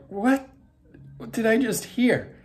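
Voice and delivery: high-pitched incredulous question; stunned disbelief. Retold or imitated. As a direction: act out a startled double take. Acting out his disbelief at overhearing.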